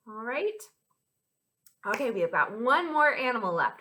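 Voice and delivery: ordinary delivery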